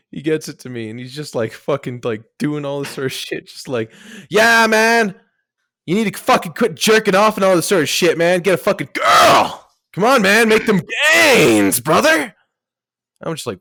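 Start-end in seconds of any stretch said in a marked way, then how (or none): 4.3-12.3 louder, higher gym bro bellowing; hammed-up bro coach shouting encouragement; yelling bro coach. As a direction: hype him up like a meathead trainer.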